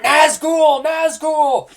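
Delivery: high, loud alarm cry; distant shout. Acted. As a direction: cry out the warning. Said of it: "hear people on the outside shout".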